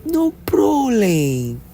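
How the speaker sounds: very high, slow gangster mimicry; exaggerated, long-drawn film-villain accent; Scarface. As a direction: drag out the last word like a parody movie gangster. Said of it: "In my Scarface".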